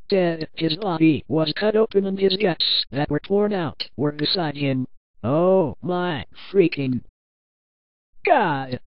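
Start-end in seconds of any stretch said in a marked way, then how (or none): 5.2-8.8 slow, low shocked exclamation; slow, drawn-out shock; stunned disbelief. A narrator's reading. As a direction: drag out the words in horrified disbelief. Narrator reacts to gory picture.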